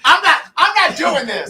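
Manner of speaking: high laugh, then slow laughing words. Real, not acted. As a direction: crack up while refusing. Bursts out laughing.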